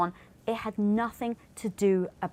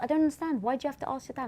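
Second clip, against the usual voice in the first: higher, puzzled question; confused disbelief; 6 semitones higher, 5 dB quieter, much faster than usual. Retold or imitated. Husband imitating his wife's baffled question about his dad. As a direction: play baffled.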